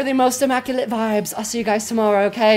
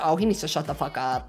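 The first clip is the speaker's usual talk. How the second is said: low, soft mutter of an annoyed viewer; a hint of a fed-up viewer, under the breath; mock viewer; 9 semitones lower, 10 dB quieter than usual. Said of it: "I said".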